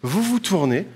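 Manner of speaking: normal talking voice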